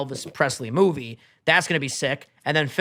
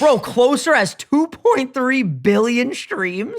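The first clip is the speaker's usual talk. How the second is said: incredulous; 7 semitones higher, 4 dB louder, slower than usual. Real, not acted. Disbelief at stream count.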